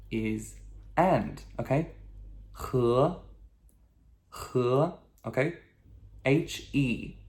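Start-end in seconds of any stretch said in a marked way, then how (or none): 2.7-3.1 "He" as huh, rising like a question; ascending second tone. Shown as a demonstration. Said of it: "with a second tone". 4.5-4.9 "he" said as huh with a questioning rise; ascending tone. Shown as a demonstration.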